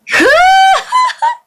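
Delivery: very high, sudden whoop of laughter. Real, not acted. Streamer breaking the sleepy mood, high whooping laugh. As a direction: burst out in a high, loud hoot. Sudden outburst after talking of calm voice.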